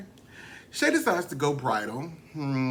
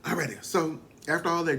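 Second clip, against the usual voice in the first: ordinary talk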